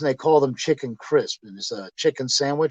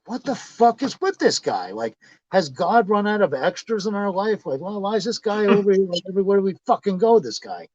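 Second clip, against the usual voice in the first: higher-pitched baffled griping; retold exasperation; 5 semitones higher than usual. Friend griping about a man turning up everywhere, exasperated. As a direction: grumble in mounting disbelief.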